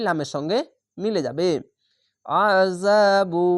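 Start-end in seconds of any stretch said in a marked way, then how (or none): 2.3-3.5 very slow আযাবু with thick আ and long যা; throat-squeezed ain. Shown as a demonstration. Teacher demonstrating the rule on আযাবু, slow and drawn out. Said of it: "আইনটিকে গলা চিপে মোটা করে পড়বেন".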